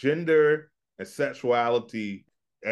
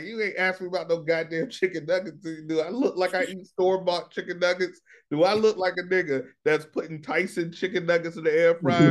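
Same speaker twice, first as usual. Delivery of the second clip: higher-pitched comic indignation; mock offended; 7 semitones higher than usual. Imitating an offended shopper asked about nuggets, mock indignation. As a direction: play affronted, piling up the questions. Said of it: "I'd be offended too".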